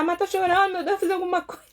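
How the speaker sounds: high, loud, hammed-up fretting; mock panic. Onlooker fearing they caused the tears, mock panic. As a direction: fret loudly, half laughing at the imitation.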